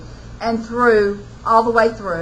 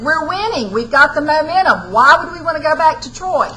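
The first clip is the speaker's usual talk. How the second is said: raised, louder angry retort; furious Hector; 5 semitones higher, 5 dB louder than usual. Acted. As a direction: Hector snapping back in anger, voice pushed up and loud. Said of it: "And Hector's furious at him".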